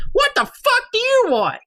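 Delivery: put-on high crotchety old woman snapping; cranky old lady. Old lady answers her husband, high cranky snap. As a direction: snap it like a grumpy old crone. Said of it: "She comes out and says".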